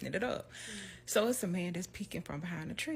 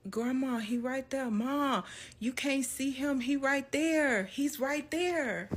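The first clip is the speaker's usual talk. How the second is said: put-on high little-girl voice, slowing at the end; child insisting; 6 semitones higher than usual. Little girl pointing out the man to grandma, high and slow. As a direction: plead with grandma to look. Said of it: "And I'm like".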